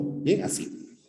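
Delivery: ordinary talk, nothing marked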